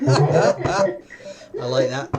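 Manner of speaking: stretched out slowly through laughter; real delighted laugh, words drawn out. Real, not acted. Laughing at the game.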